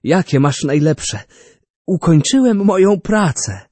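an inventor calling for wine to celebrate; eager old inventor. A narrator's reading. Narrator voices Orbano.

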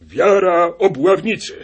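high, loud announcement of the muster; rally the hunters like a leader; solemn proclamation. A narrator's reading. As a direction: huntsman summoning the hunters. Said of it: "rzekł".

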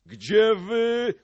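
high, loud rallying cry; drawn-out battle call; crowd shout. A narrator's reading. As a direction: shout it as a whole rowdy crowd would. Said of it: "krzyknęła szlachta".

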